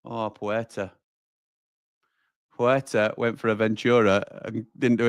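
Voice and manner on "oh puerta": normal talking voice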